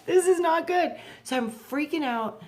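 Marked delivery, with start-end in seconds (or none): 0.1-1.0 much higher and louder panicked cry; alarmed shout. Retold or imitated. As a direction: yelp it like the world just ended. Retold freaking out in heavens.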